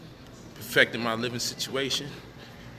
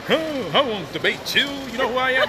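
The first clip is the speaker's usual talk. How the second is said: whiny, higher mock voice of a scared debater; mock scared opponent; 9 semitones higher than usual. Mocking rivals who dodge a debate, hammed-up whiny voice. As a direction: act out a rival who backs down, half-crying. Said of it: "they gonna act all scary, start crying".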